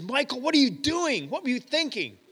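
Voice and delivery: high, mock-scolding mother voice; retold angry mom. Mom scolding her five-year-old son, hammed-up high and angry. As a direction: play the frantic mom calling her son. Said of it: "She's really mad at me".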